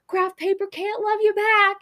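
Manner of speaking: high, loud put-on voice; hammed-up pronouncement; mock character voice. The store guy answers Kit in a high hammed-up voice.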